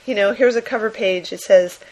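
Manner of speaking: usual speaking voice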